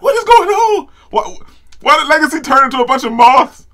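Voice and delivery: high, loud, baffled yelling; shocked shout. Real, not acted. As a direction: blurt it out in total disbelief. Reacting to moths transformation.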